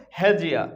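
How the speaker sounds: "হ্যাজিয়া" with d and y fused into j; linked pronunciation. Shown as a demonstration. Teacher shows what it becomes, "হ্যাজিয়া" with a j link. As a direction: run it together as he-jia, "হ্যাজিয়া". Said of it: "এটা কি উচ্চারণ হবে".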